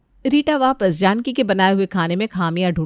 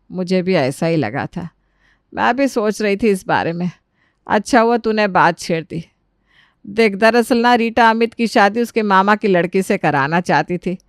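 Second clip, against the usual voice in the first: put-on voice of an elder relative; confiding mother-in-law. Mother-in-law explaining the old grudge to daughter-in-law, confiding.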